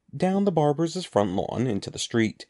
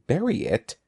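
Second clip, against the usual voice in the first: higher-pitched, taken-aback query on "Bury it"; surprised "Bury it?", pitched up; incredulous question; 5 semitones higher than usual. A narrator's reading. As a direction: echo it in disbelief. Andy startled by Evan's order.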